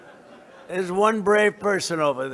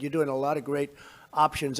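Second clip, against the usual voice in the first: ordinary delivery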